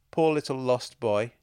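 teasing, pitying coo; mock concern. A narrator's reading. Woman stroking the narrator's arm, in mock concern. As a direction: pretend to feel sorry for him. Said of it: "in mock concern".

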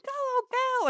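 put-on high, thrilled old man's cheer; excited old grandad cheer. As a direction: cheer her on like a proud grandad.

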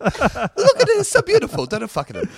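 high, hammed-up Italian protest; mock Italian accent. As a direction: plead like a scandalised comic Italian, half laughing. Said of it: "little old Naples at the end".

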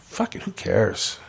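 bleeding on the couch, a soft, slow, resigned thought. Retold or imitated. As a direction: let it slip out slowly as if giving up. Inner thought while bleeding out.

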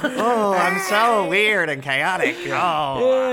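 high, loud, hammed-up manic director; mock Terry Gilliam. Mocking the director to friends, high hammed-up squeal. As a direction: squeal like a wild eccentric auteur. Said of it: "Like old Uncle Terry".

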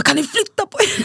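high-pitched, impolite mock demand; imitated rude demand. Man telling crowd to move, high-pitched rude mimicry. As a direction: play someone curtly ordering people aside. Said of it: "Du kunde sagt det lite trevligare".

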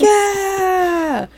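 high, drawn-out yell; excited crowd cheer. Acted. Crowd keen to throw peanuts, high excited cheer.

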